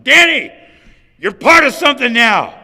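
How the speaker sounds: a big, high call across the lot; yelled across a parking lot. Retold or imitated. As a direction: shout it so he hears it far away. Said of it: "He yelled across the parking lot".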